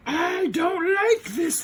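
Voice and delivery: very high, slow cartoon villain whine; whiny villainess. Rita complaining that the Rangers are winning, high villainess whine. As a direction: squeal it out like a sulking villainess.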